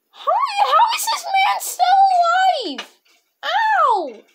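laughing and yelling at the game; laughing shout. Real, not acted. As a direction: shriek through laughter.

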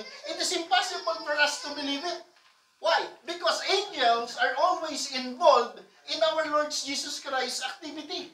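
ordinary talk

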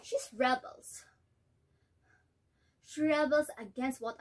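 worn-out mother, resigned and hurt; disappointed mother; weary mother. Acted. Nella recalls obedience, despairs of daughter.

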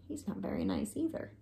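quick hushed aside; soft secretive remark; whisper. Real, not acted.

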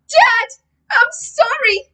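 high, loud desperate apology; crying out. A narrator's reading. Boy apologises to Dad in the doorway, high desperate cry. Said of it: "I cried".

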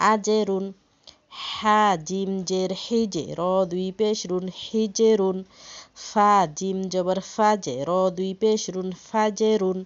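loud, spaced letter-by-letter chanting; spelling drill. Shown as a demonstration. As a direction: call out each letter for the class to follow.

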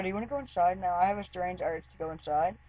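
soft, scared, hushed question; hushed whisper-like. Retold or imitated. Asking her friend to go inside, hushed. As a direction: keep it low so nobody hears. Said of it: "I go".

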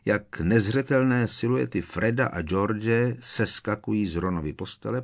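usual speaking voice

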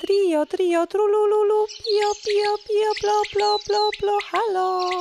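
high, sing-song nightingale calls; bright high bird trilling, well above the narrator. A narrator's reading. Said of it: "Pierwszy słowik zaczął tak".

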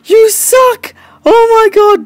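high, loud victory shouts; triumphant yelling. Real, not acted. Player gloating over a beaten game enemy, triumphant yell.

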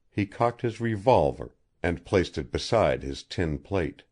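plain reading voice

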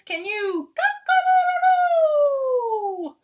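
high, slow rooster-style question; high loud slow rooster call; crowing invite. Acted. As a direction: sing the crow out to the kids. Teacher invites kids to crow.

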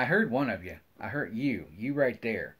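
usual speaking voice

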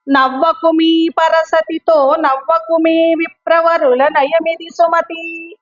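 slow, measured chanting of the verse; poem recitation. Shown as a demonstration. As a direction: recite the poem slowly like a teacher. Recites second poem slowly. (నెమ్మదిగా పద్య పఠనం)

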